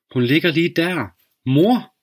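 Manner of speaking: put-on a frightened child pleading for her mother; desperate child. Girl desperately calling mother. (en bange pige, der råber stadig højere)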